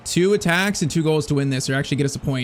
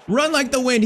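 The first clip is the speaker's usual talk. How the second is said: excited urging; 7 semitones higher than usual. Real, not acted. Urging his player forward.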